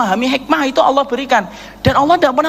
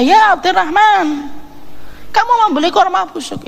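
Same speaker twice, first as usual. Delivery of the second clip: incredulous questioner; 7 semitones higher than usual. Acted. Townspeople question Abdurrahman about buying rotten dates, incredulous higher voice. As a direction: ask it like a shocked onlooker.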